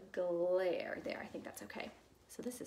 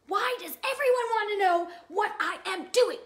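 high, loud exasperated outburst; explode, hammering the last words; shouting; 13 semitones higher, 13 dB louder than usual. Acted. Bird sick of everyone asking, high exasperated shout. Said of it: "shouted Bird".

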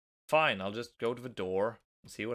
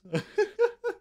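very high nervous laugh; 4 dB louder than usual. Real, not acted. Nervous laugh at spooky scene.

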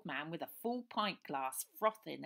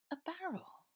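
puzzled question; 13 semitones higher than usual. Acted. Posh girl puzzled.

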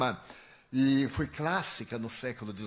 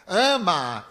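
sharp command; 4 semitones higher, 10 dB louder than usual. Retold or imitated. Spirit commands him to love.

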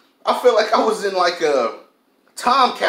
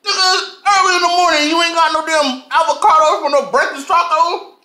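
outraged rant; 8 semitones higher than usual. Retold or imitated. Ranting at taco shop.

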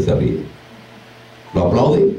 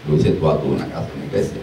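demon speaking; 8 semitones lower, 4 dB quieter than usual. Retold or imitated. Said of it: "El demonio hablando".

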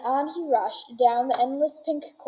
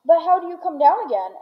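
put-on higher, louder, fast little boy voice; lightly voiced curious child; child's question; 6 semitones higher, 6 dB louder, faster than usual. A narrator's reading. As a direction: ask like an eager small boy. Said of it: "asked little Charlie".

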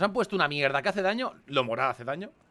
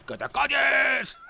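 mock yell; 8 semitones higher, 3 dB louder, much slower than usual. Yelling at enemy in game.

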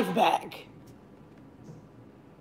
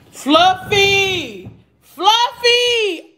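high call across the house; high-pitched call for Fluffy; 9 semitones higher, much slower than usual. Real, not acted. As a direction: shout the name out high. Calling out to someone.

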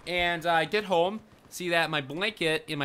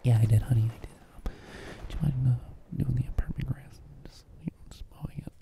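whispery mock mother voice; breathy, sweet whispered mother; whispered mom; 3 semitones lower than usual. Mother sweetly asking son a favour, whispered mock voice. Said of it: "mom's reply voiced".

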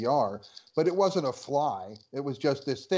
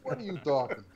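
put-on New York accent. Ribbing Bob at work, playful New York accent. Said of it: "I'd start doing my New York accent".

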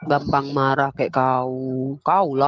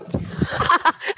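squealing giggle, high and airy; high laughing squeal; high squeaky laugh; 13 semitones higher than usual. Real, not acted. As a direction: burst into a shrill breathy giggle. Laughs at insult.

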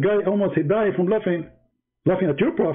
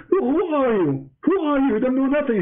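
indignant challenge; 9 semitones higher than usual. Real, not acted. Scolding a debate opponent, high and indignant. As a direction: pitch jumps high, demanding who he is.

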